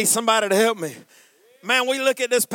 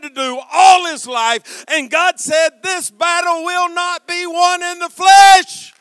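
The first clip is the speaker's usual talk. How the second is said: loud, high preacher's shout, building; full-force preaching; 8 semitones higher, 9 dB louder than usual. Real, not acted. Preacher telling the congregation God started the fight, full-force shout. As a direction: drive each phrase out like a revival preacher.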